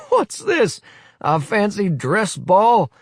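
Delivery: high, loud mocking question; amused surprise. Willy mocking the uniformed boys, amused scoff. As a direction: scoff at the costumes. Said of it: "looks about him in surprise".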